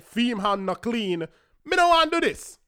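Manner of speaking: imitating a Jamaican patois voice, rising to a loud protest at the end; put-on Jamaican patois pleading with his date; light Jamaican patois complaint. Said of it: "Me should have put on my foot and say".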